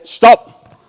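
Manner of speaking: sharp loud call to halt; called-out stop. Real, not acted. Coach halting players mid-drill, loud call. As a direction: stop them dead from across the court.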